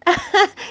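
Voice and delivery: high giggle; bunny giggle. Acted. The bunny giggling as mum wears his scarf. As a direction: giggle delightedly.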